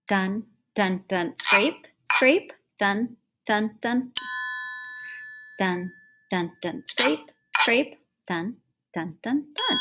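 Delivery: dun and scrape sung out as instrument sounds; vocal rhythm pattern. Shown as a demonstration. Teacher modelling the rhythm pattern for students, vocal beats. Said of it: "Now notice I made a pattern".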